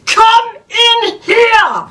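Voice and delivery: put-on slow, high bellowed German accent; mock German bellow, slow and high. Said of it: "in a German accent".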